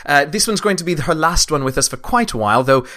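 put-on a light British accent on the whole announcement. Dungeon master reading mid-show announcements in a light British accent. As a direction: read the podcast plugs in a hinted British accent. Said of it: "decided to do it all in an accent".

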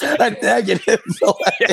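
high, laughing through the words; laughing at own joke. Real, not acted. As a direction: giggle out the punchline.

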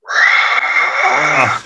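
imitated roar of a distant crowd; breathy mock stadium roar, held long; dull crowd roar. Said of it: "like, this dull roar".

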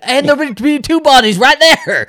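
cracking up while spinning the idea; giggly high joke voice; laughing. Real, not acted. Joking about bodies, laughing.

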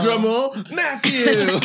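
high sing-song drum roll call; mock drum roll. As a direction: hype it up, voice way up high. Teasing drum roll buildup.

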